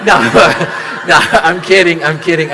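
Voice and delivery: loud laugh into "no, I'm kidding"; cracking up, loud, taking it back; laughing off the joke loudly. Real, not acted.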